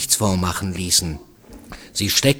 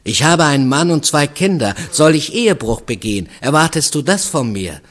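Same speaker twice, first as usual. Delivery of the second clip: higher, louder string of affronted questions; indignant outburst; 6 semitones higher, 5 dB louder, faster than usual. A narrator's reading. Married mother refusing a stranger's proposition, indignant. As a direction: throw the questions at him indignantly.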